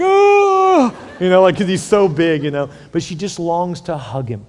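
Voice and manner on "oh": high squeezed oh, like being crushed under a big son; a loud high squeak of breath pressed out; a high, loud, squashed "Oh". Retold or imitated. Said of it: "she's like".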